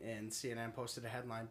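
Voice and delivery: usual speaking voice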